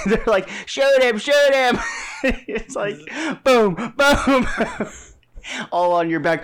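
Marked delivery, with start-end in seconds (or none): 0.7-1.8 high, loud pretend order, fired twice; mock urgent yell. Co-host voicing shooters after the fleeing victim, mock yell. 3.4-4.0 louder, higher mock booms; imitated gunshots. Co-host acting out shots in the back, imitated gunfire.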